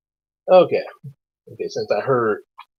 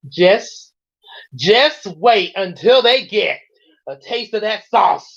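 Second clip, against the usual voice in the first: higher, slightly slow imitation of Zach; impression of Zach; 7 semitones higher, slower than usual. Host imitating his guest Zach, higher put-on voice. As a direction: pitch up to mimic your guest's voice. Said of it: "I'll try my best to do your voice there".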